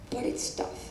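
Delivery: acted inner doubt, barely voiced; hushed doubt. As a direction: drop to a soft, almost whispered admission. Acted doubt after confident yes.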